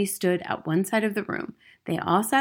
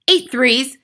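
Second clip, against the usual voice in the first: high, loud shout; 6 semitones higher, 10 dB louder than usual. A narrator's reading. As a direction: drum it into the pupil. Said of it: "the Trunchbull shouted".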